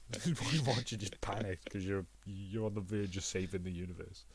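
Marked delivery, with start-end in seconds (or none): none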